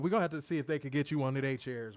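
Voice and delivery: ordinary talk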